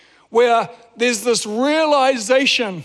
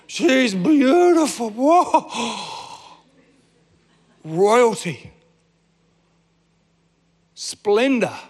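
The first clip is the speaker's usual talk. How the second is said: awestruck; much slower than usual. Retold or imitated. Awestruck meeting Princess Diana.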